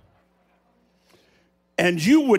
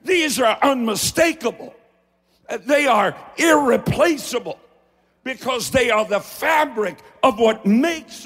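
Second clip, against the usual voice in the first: fervent preaching; 4 semitones higher than usual. Acted. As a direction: preach it with growing fire. Preaching builds to a peak.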